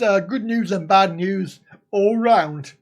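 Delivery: normal talking voice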